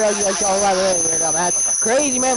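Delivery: normal talking voice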